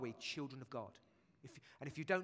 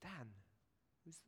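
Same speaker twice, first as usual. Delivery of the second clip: mock forgetful dad; 5 semitones higher than usual. [name] voices forgetful dad Jason.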